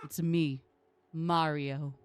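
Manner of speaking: loud, low classic Mario catchphrase; Mario impression. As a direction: belt it like the cartoon plumber. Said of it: "repeats the line in his own take".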